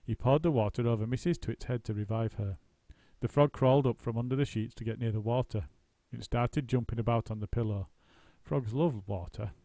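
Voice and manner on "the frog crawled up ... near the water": usual speaking voice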